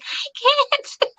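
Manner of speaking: strained squeak. Retold or imitated. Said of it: "I was trying so hard to talk normally".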